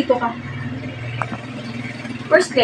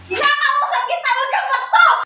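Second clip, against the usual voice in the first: high-pitched parental scolding; sharp, high reprimand; indignant scolding; 6 semitones higher than usual. Acted. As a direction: call back a rude child, exasperated. Parent scolding child who walks away.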